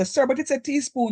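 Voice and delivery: high, confused student question, mocked. Teacher mimics a student asking what it means, mock confusion. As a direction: ask it like a puzzled pupil, lightly mocked. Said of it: "And don't say".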